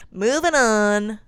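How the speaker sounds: loud, drawn-out call; shouted transition. Pretend. Host moves to the next movie, loud drawn-out shout. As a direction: holler it to end the segment, stretching it.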